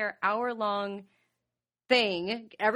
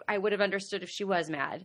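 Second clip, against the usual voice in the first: normal talking voice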